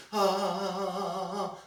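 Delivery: high wavering ah; old people's wobbly tone. Shown as a demonstration. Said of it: "Like old people like to".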